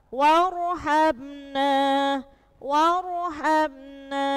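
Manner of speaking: warhabna very slow, ro read thick and full; heavy ro. Shown as a demonstration. Teacher drills students on a heavy ro, slow demo. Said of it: "Jadi dibaca waru, tebal".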